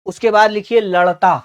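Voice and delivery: teacher calling out words to copy down; slow dictation. Real, not acted. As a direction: dictate each word clearly, then wait for writing. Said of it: "धीरे धीरे बोलूंगा".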